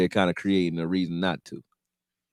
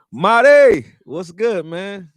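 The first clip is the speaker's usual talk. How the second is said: excited greeting; 9 semitones higher, 9 dB louder, much slower than usual. Real, not acted. Greeting a viewer on stream.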